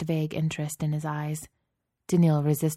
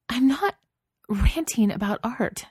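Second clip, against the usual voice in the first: helpless; 7 semitones higher, 3 dB louder than usual. A narrator's reading. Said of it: "she said helplessly".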